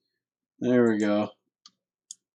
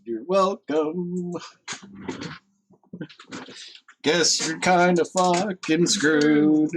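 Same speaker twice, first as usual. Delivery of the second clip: gloating taunt; 6 semitones higher, slower than usual. Real, not acted. Gloating over enemy's closed zone.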